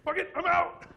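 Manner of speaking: high, loud shout; panicked yell. Retold or imitated. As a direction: blurt it out high and loud, bolting. Startled retreat from a voice.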